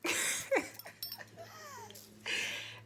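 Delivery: very high squealing laughter; real laugh. Real, not acted. Speaker laughs at her own escalation, squealing. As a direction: crack up in a long, high laugh.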